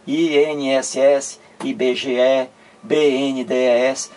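I N S S, I B G E, B N D E S as separate letter names. Shown as a demonstration. Teacher listing examples of acronyms pronounced individually, spelled out. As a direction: spell each one: I N S S, I B G E, B N D E S. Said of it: "cada letra individualmente".

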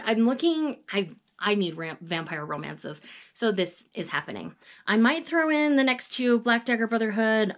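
ordinary talk, nothing marked

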